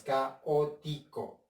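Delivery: "caótico" slowly split ca - o - ti - co; soft, slow syllable count on "caótico", ca, o, ti, co. Shown as a demonstration. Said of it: "Ya las separé en sílabas".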